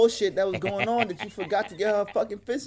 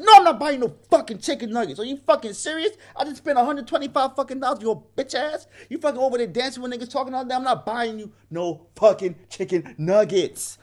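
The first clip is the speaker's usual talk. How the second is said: raised, higher voice of outraged refusal; angry retort; 6 semitones higher, 3 dB louder than usual. Retold or imitated. Man refusing to buy a woman nuggets, angry retort. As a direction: act out an exasperated telling-off. Acted quarrel over buying nuggets.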